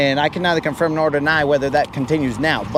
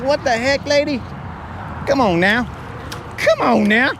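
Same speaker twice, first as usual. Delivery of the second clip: mock indignant protest; 7 semitones higher than usual. Protesting to nurse in retold story.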